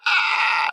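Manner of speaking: high shrill mock scream; mock crazy scream. Retelling Seth's scream in a high mock shriek. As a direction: imitate a terrified scream. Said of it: "he does this, like, crazy scream".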